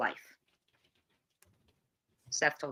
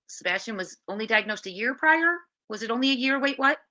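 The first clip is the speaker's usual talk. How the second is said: surprised; 7 semitones higher, faster than usual. Real, not acted. Shocked by a new detail.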